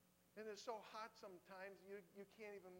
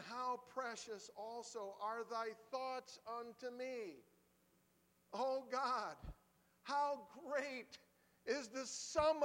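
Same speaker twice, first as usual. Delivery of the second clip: loud, higher, slow scripture reading at full force; preaching scripture; 8 semitones higher, 13 dB louder, slower than usual. Acted. Preacher reads the psalm to congregation, full-force proclamation. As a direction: proclaim the verse, hitting each phrase hard.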